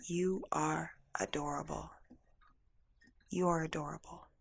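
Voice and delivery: soft, unhurried reassurance; hushed and tender; tender retold voice. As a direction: voice a gentle message from beyond, softly. Tender voice retold at emotional peak.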